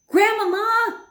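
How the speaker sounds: very high, slow, loud call; boy shouting for grandmama at the door. A narrator's reading. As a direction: shout through the door with all your might. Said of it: "I shouted as loudly as I possibly could".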